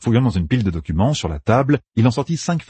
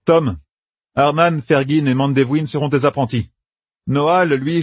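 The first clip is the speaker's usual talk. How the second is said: lightly raised, eager commander; planning aloud with brisk confidence; a hint of a young general laying out plans; Matt's voice; 6 semitones higher than usual. A narrator's reading. Narrator voices Matt.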